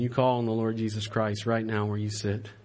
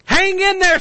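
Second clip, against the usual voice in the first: "Hang in there" cried even higher and louder; rising cry; 11 dB louder than usual. Acted. Preacher crying out to the sisters, at a peak. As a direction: push the repeat up to a peak. Repeated exhortation, built higher.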